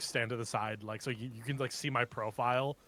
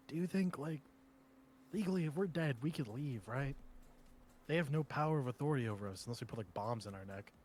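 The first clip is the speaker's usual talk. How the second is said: whispering; 9 dB quieter than usual. Acted. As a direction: lean in and whisper. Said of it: "You can whisper it".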